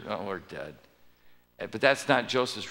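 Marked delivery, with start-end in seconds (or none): 0.1-0.8 much softer, lower resigned fear; mock dread. Brothers sure they will die, soft and lower. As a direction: breathe it out as doomed. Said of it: "and they're sure".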